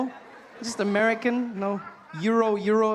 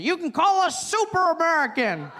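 proud Southern man; 7 semitones higher, 4 dB louder than usual. Retold or imitated. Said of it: "He said".

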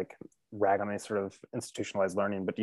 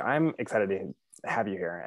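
ordinary delivery

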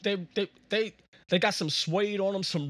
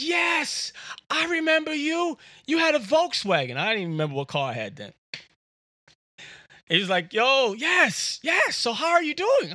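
higher, loud shouted yes; excited recognition; 7 semitones higher, 3 dB louder than usual. Retold or imitated. Joe remembering the caller on the phone, excited shouting. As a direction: burst out as it all comes back.

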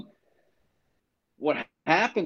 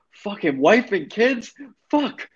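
high-pitched mock rant at the henchman; hammed-up furious refusal, pitched way up; imitating a screaming Tony; 9 semitones higher than usual. Tony screams at the henchman over the kids, mock yell. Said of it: "He just starts screaming at the guy".

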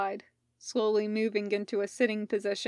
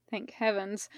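ordinary delivery

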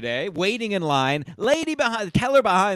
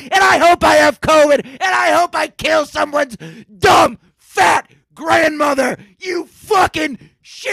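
furious rant; 8 semitones higher, 6 dB louder than usual. Real, not acted. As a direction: roar it out like you own the place. Ranting about bank, mask mandate.

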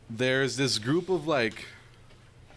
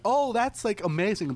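high-pitched gushing imitation; gushing tourist girl; 9 semitones higher than usual. Tourist girl answering visitors from New Orleans, high gushing imitation. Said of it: "and she's like".

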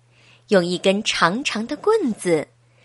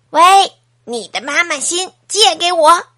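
a hint of a bratty boy, louder; cheeky boy grabbing; 5 semitones higher, 7 dB louder than usual. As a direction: taunt while running off with it. 阿志抢了就跑. (调皮男孩边跑边喊)

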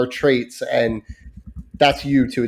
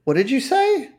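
high, shocked mother voice; wife to daughter, a mildly shocked high question; imitating wife; 12 semitones higher than usual. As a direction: ask it like a mom who can't believe her ears. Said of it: "My wife goes".

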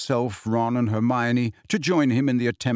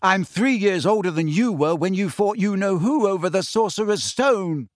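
fierce, higher-pitched retort; higher voice, standing your ground; 6 semitones higher than usual. A narrator's reading. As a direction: argue your case fiercely. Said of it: "she said fiercely".

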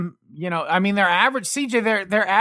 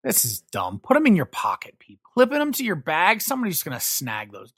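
ordinary talk, nothing marked

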